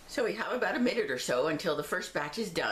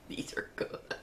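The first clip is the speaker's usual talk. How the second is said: whisper; 7 dB quieter, slower than usual. Real, not acted. Whispered while eating.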